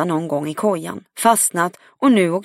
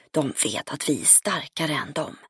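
hushed, much softer confidential aside; whispered; 3 semitones lower, 9 dB quieter than usual. A narrator's reading. Friend leans in over lunch to confide, whispered. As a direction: drop your voice as if sharing a secret. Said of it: "viskade hon".

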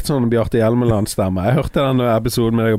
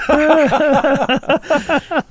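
drawn-out laugh, pitched much higher; long high laugh; 14 semitones higher than usual. Real, not acted. (lang, høy latter)

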